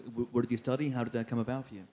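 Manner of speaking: ordinary delivery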